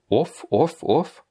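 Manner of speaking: "off" ending on a plain hard f; no glide after the f in "off"; hard final f. Shown as a demonstration. Teacher isolates the ending of Petrov, hard f. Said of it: "c'est, c'est dur".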